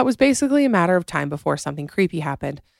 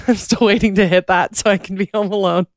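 laughing through the words, pitched up; 4 semitones higher, 4 dB louder than usual. Real, not acted. Host admits still waiting to be home alone, laughing. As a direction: joke at your own expense through laughter.

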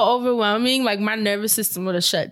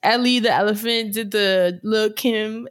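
ordinary talk

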